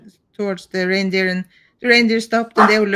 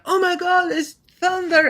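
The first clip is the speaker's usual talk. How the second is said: panicked; 9 semitones higher than usual. Retold or imitated. Kid's family panicking over thunder.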